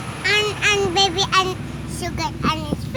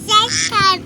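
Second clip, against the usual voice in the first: normal talking voice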